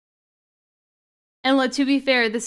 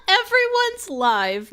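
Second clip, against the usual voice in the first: laughing; 11 semitones higher, 3 dB louder, slower than usual. Real, not acted. Streamer cracking up at chat.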